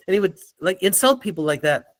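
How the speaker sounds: normal talking voice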